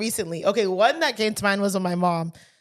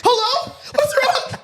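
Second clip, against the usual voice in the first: much higher, hammed-up worried questions; imitated alarm; 13 semitones higher, 3 dB louder, slower than usual. Imitating aunt hearing police are calling, alarmed. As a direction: mimic sudden panic on the phone. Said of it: "her voice changed".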